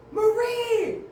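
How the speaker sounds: loud high yell; yelling a name. Retold or imitated. Uncle yelling for Marie, loud and high. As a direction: shout for Marie across the lot.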